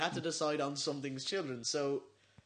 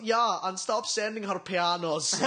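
put-on slow German-accented complaint; 3 semitones higher, 4 dB louder, slower than usual. Boyfriend complaining about pianos, slow German accent. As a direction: draw it out in a mock German accent. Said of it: "you do a German accent".

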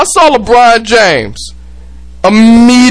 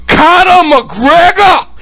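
slow, high, mock Irish accent; 6 semitones higher, slower than usual. Shown as a demonstration. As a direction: announce the name in a put-on Irish accent, drawn out. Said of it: "I gotta do it in my Irish accent".

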